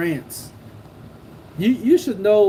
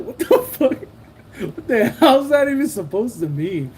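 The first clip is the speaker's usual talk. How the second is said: incredulous; 5 semitones higher, 4 dB louder than usual. Retold or imitated. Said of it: "then I was like".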